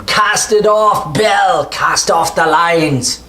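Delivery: high, slow desperate yell over the engine; gruff shouting over tugboat engine. Acted. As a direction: shout it across the dock, drawn out. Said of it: "you can hear him".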